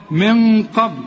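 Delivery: من قبل with a held, dark nasal ن; heavy ghunna. Shown as a demonstration. Teacher repeats the example, من قبل hummed long and heavy. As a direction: darken the hum before the ق of قبل. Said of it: "مفخمة ازاي علشان القاف".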